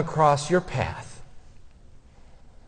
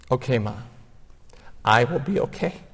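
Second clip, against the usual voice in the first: soft, teary voice, giving in; tearful, obedient; 3 semitones lower, 6 dB quieter, faster than usual. Shown as a demonstration. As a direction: say it through tears like an obedient son. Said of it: "said through my tears, like this".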